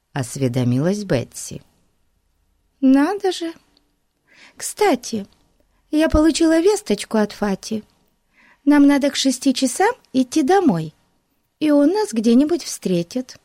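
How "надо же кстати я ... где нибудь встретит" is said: put-on girl voice. A narrator's reading. Betsy voiced.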